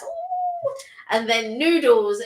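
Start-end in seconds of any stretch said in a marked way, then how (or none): 0.0-0.7 very high squeal of delight; delighted ooh. Real, not acted. Guest imagining fried rice and noodles, high delighted ooh. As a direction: coo over the food. Craving favourite Chinese dishes.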